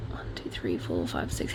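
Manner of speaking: low, soft half-whispered count; hushed aside to yourself. Real, not acted. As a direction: count to six under your breath. Counting to self mid-report.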